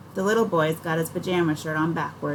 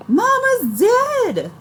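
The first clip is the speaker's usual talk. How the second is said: very high, loud, slow drawn-out wail; 8 dB louder, much slower than usual. A narrator's reading. Little boy wailing over his fallen mother, high slow wail. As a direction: wail it like a small child in terror. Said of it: "Buddy wails and starts bawling".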